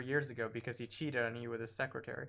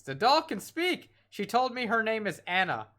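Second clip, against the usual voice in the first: put-on excited little girl; 8 semitones higher, 5 dB louder than usual. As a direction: play the girl amazed by her doll. Voicing the child Liza's line.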